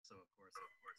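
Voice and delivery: almost no sound, far from the mic; barely audible. Real, not acted. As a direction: voice fading to a whisper. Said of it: "I can barely hear him now".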